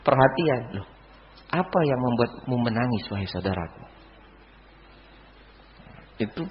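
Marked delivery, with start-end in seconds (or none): 1.5-3.8 soft, low, concerned question; caring attention. Retold or imitated. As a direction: imitate tender concern, trailing down softly. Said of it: "yang menunjukkan apa? Perhatian". (bertanya penuh perhatian, suara lembut)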